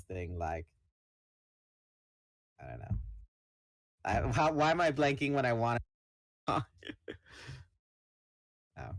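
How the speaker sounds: normal talking voice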